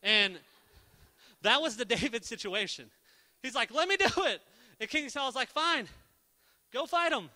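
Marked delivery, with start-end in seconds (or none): 3.7-4.4 higher, louder eager plea; eager begging. Retold or imitated. David begging King Saul to let him fight, loud eager plea. 5.5-7.3 exasperated giving in. Retold or imitated. Saul giving in.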